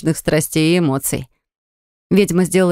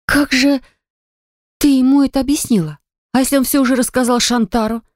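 high squealing half-whisper; hushed squeal; 7 semitones higher than usual. Acted. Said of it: "заверещала ей тихонько в ухо".

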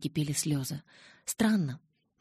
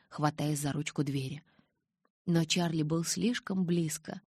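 usual speaking voice

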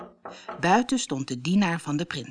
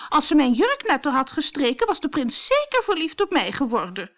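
high-pitched, petulant complaint; whining; 9 semitones higher, 4 dB louder than usual. A narrator's reading. Bertha blaming Cinderella for her creased dress, whining. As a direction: keep whining about being wronged. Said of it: "zeurde Bertha".

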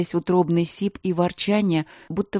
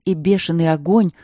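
ordinary delivery

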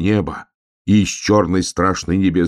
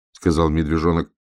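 plain reading voice

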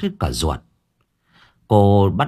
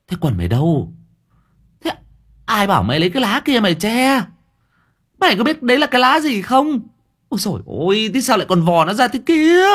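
much higher, loud scolding voice; shrill scolding woman; 13 semitones higher, 3 dB louder, faster than usual. A narrator's reading. Woman scolding the boy over the leaf, high loud scolding. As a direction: scold him, half laughing at him. Thơm scolds and mocks Tưởng.

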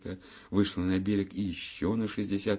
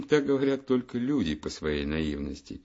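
ordinary delivery

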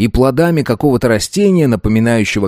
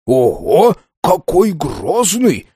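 mock fright; 5 semitones higher, much slower than usual. A narrator's reading. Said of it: "В притворном испуге".

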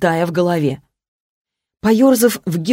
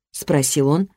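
ordinary delivery, nothing marked

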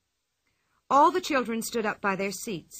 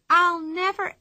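excited exclaim; 9 semitones higher, 6 dB louder than usual. A narrator's reading. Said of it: "tall tale opening".

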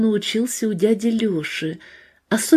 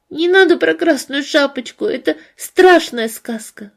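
put-on higher, whiny little girl pleading; whiny spoiled pouty drawl; 6 semitones higher, 4 dB louder than usual. A narrator's reading. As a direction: whine it like a spoiled child. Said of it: "закапризничала Людочка".

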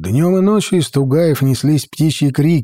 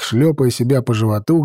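ordinary delivery

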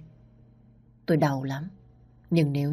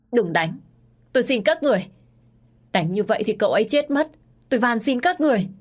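louder, higher tearful begging; crying plea; 7 semitones higher, 4 dB louder than usual. A narrator's reading. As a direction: beg the thugs to stop, crying. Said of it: "Tôi lúc này vẫn cứ khóc lóc". (khóc lóc van xin bọn côn đồ)

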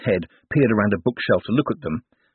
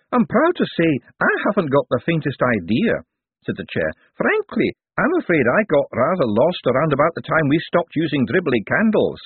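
lightly voiced old wizard, higher pitched; a kindly old academic wizard, pitched up; elderly wizard; 8 semitones higher than usual. Elderly wizard greeting the visitors, higher voice.

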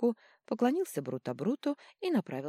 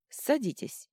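short, higher curt order; curt command; 8 semitones higher, 3 dB louder, slower than usual. A narrator's reading. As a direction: toss it off briskly. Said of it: "бросила Никорин".

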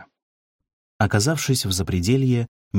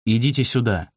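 narrator's restrained raised call; called-out order; 3 semitones higher than usual. A narrator's reading. Sergeant calls the youths over, mildly shouted order. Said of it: "Затем крикнул юнгфронтовцам".

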